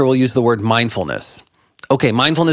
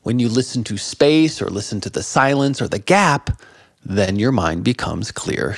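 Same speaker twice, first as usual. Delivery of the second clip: ordinary talk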